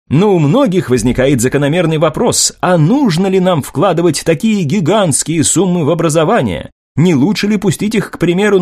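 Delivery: polished reporter voice, a touch lifted; newsreel reporter. Acted. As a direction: read it as a confident TV correspondent. Reporter poses questions.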